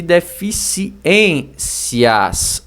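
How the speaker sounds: "deficiências" broken into slow, separate syllables. Shown as a demonstration. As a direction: sound out "deficiências" piece by piece. Teacher splits word into syllables.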